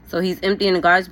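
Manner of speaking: ordinary delivery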